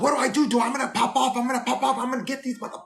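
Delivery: high, fast-rising outraged rant; furious rant. Retold or imitated. Flight attendant to coworker about the stolen wallet, high outraged rant. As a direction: vent like your wallet was just stolen.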